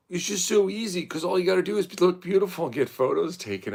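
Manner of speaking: high mocking voice; mocking airhead voice. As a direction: mimic dismissive people. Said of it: "They go".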